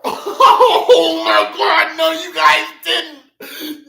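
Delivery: overcome, laughing and sobbing at once; real tearful joy. Real, not acted. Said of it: "You guys literally just made me start crying".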